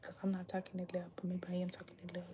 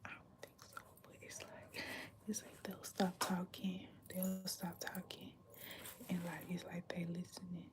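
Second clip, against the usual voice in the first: unsure hushed whisper; whisper, wary of eavesdroppers; quiet secretive whisper; 8 dB quieter than usual. Real, not acted. Whispering in public.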